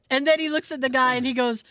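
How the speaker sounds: ordinary talk, nothing marked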